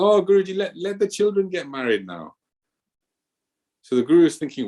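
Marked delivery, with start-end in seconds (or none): 0.0-2.3 high, hammed-up pleading; pleading disciple. Disciple begging the guru to wait for the weddings, high mock pleading. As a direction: whine out one more excuse.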